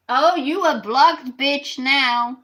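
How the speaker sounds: slow, loud, sing-song jeer; mocking taunt. Real, not acted. Streamer jeering at a viewer just blocked, slow gloating taunt. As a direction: drag it out to gloat.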